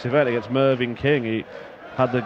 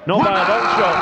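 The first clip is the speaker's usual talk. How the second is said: louder, higher, excited commentary; 7 semitones higher, 5 dB louder than usual. Real, not acted. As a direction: punch it out with energy. Commentator hyping up the leg.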